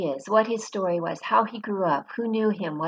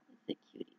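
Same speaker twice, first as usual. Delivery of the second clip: whispered cooing; 16 dB quieter than usual. Real, not acted. Cooing over dog photo.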